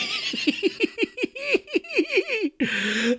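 long high squealing laugh; real high-pitched laughing fit; helpless laughter. Real, not acted. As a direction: laugh yourself into a thin squeal. Laughs after own outburst.

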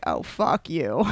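low, soft mock-annoyed aside; retold muttered annoyance. Wife recalling her reply to her sick husband, muttered annoyance. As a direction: toss it off low and under your voice.

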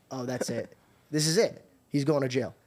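low, quiet inner voice; softly acted sinking realization; resigned dread. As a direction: drop the voice as if all is lost. Acted resigned thought seeing cops.

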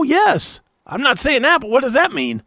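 normal talking voice

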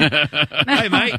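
mimicking an Aussie, laughing; mock Australian accent. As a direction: echo it in a hammed-up Australian accent. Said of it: "In an Australian accent?".

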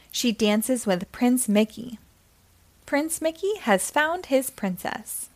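plain reading voice

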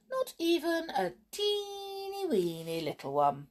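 put-on high, squeaky teeny-weeny voice; teasing refusal. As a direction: rub it in with a high-pitched tease. Spitefully refusing to share toffees.

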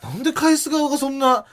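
ordinary talk

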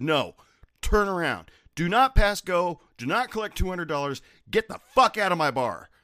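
high, loud mock-game order; retold loud order. Bartender ejecting a banned man, loud and high. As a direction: throw him out with the game line.

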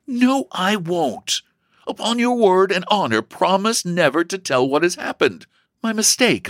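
put-on high-pitched, emphatic girl; insistent. A narrator's reading. Sister makes brother promise secrecy, insistent. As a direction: refuse firmly, then press for the promise.

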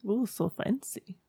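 soft, teasing ooh of false wonder; mock awe. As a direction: pretend to be dazzled, softly. Teasing Emma's college.

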